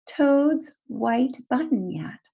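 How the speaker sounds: usual speaking voice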